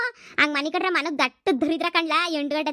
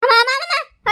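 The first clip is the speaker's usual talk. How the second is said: high loud yelps at icy water; shriek from cold water; 10 semitones higher, 8 dB louder than usual. Acted. Drenched sleeper shrieks at grandma's cold water, high yelps. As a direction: scream as cold water hits.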